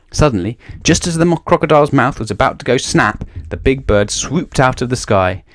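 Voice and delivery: plain reading voice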